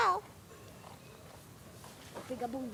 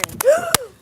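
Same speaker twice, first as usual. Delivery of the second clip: loud gasp; 16 dB louder than usual. Pretend. Fake fright at game start.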